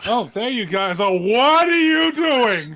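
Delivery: loud, high, slow in-character shock; loud drawn-out gasp of discovery; surprised outburst. Acted. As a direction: call out loud, high and stretched, catching them red-handed. Character walks in on scene.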